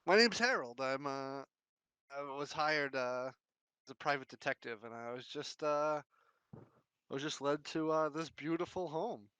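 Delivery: chirpy would-be private eye, talking up a stranger; detective Harold. Acted. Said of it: "he says".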